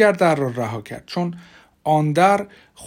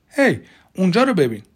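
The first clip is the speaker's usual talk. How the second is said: raised, higher call for attention; 5 semitones higher, 5 dB louder than usual. Acted. Deputy pointing his partner to an open hatch, calling out. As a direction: call your partner's attention across the room.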